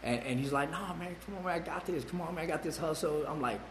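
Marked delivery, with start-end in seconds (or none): 0.7-3.2 friend voice. Retold or imitated. Friend tempting him in story.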